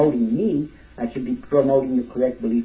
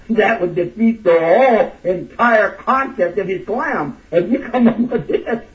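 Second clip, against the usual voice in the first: laughing disbelief; 6 semitones higher, 6 dB louder, slower than usual. Real, not acted.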